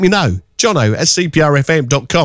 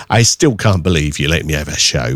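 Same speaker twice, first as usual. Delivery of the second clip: ordinary talk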